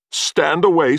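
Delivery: high, loud warning; threatening warning. A narrator's reading. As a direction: order him back, knife in hand. Man warns off partner with knife.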